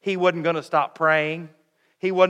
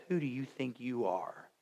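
much lower and softer, a cold sneer; scornful put-down; 4 semitones lower, 15 dB quieter than usual. Acted. Older brother sneering at David: quiet scorn. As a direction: say it low with quiet contempt.

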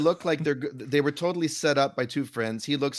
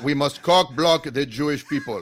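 loud, hammed-up Yiddish-sounding villain; mock Yiddish accent; 7 dB louder than usual. Podcaster pretending to be Hamas, badly faked Yiddish-sounding accent. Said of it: "making them sound y-, Yiddish".

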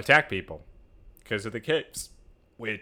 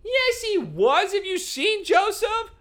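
very high, squeaky protest; incredulous objection; slower than usual. Real, not acted. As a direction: object in high-pitched disbelief. Heated disagreement over Joseph.

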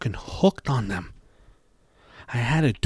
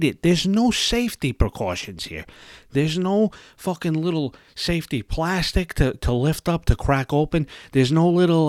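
exasperated rant; 6 semitones higher than usual. Real, not acted. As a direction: rant at the missing seal, rising. Ranting about unsealed ice cream.

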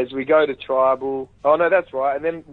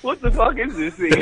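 higher, laughing through a baffled quote; laughing disbelief; 10 semitones higher than usual. Retold or imitated. Retelling Jonathan's baffled reaction, laughing throughout. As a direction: play the baffled host while cracking up. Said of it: "Jonathan's just going".

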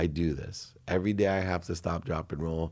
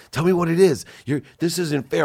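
much higher, louder mock demand; mock insistent wife; 9 semitones higher, 6 dB louder than usual. Guest imitating his wife demanding to know, higher and louder. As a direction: ham up the wife pressing for answers.

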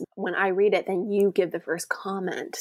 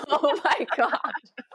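breathless laugh through it, slow; helpless real laughter, drawn out; 6 semitones higher, much slower than usual. Real, not acted. Co-host laughing at her prank reveal.

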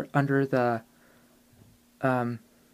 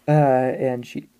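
normal talking voice

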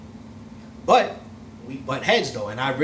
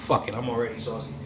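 normal talking voice

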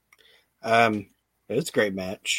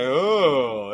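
mock crowd oh. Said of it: "They're just like".

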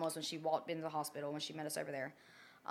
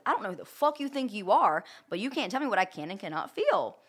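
raised, heated retort, pitch up; retold anger; 4 semitones higher, 6 dB louder than usual. Snapping at the doctor who says she cannot feel it, heated. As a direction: tell her off with rising heat.

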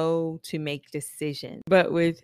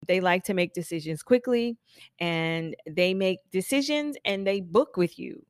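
ordinary talk

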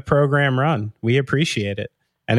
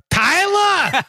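very high-pitched mock holler; imitated dad's yell; 3 dB louder than usual. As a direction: call out like a dad yelling through the house. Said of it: "used to yell around the house".

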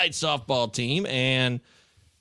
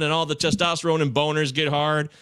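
ordinary delivery

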